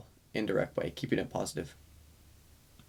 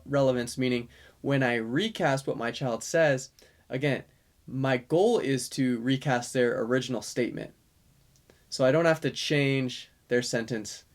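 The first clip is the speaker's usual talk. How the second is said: ordinary delivery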